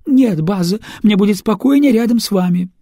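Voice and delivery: cling to him, frightened; anxious insistence. Acted. Frightened singer refuses.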